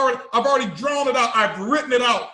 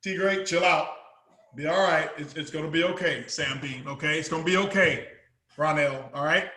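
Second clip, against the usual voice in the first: low, much softer soothing voice, easy now; calming reassurance; 8 semitones lower, 6 dB quieter than usual. Acted. Speaker calming jumpy fans by name, low soft reassurance. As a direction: settle each fan down gently, low and soft.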